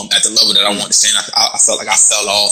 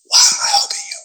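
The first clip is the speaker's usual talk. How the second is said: whispered in the ear, voiceless. Retold or imitated. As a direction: breathe it right into his ear. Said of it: "Like that".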